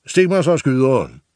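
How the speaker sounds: loud, high butcher's order; loud commanding slaughterman; barked command. Acted. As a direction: bark it across the yard like a butcher. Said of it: "udstødte sin næste kommando".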